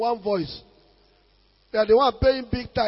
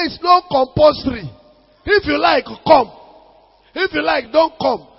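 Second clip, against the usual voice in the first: loud, raised-pitch rebuke; forceful retort; 6 semitones higher, 10 dB louder than usual. Retold or imitated. Pastor answering grumbling members, forceful retort. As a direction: tell off grumblers at full preaching volume.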